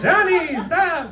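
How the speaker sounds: mock mother's high-pitched protest. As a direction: squeak it out like his mother pushing him off. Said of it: "she'd be like".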